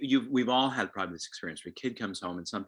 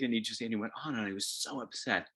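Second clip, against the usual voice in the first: ordinary talk, nothing marked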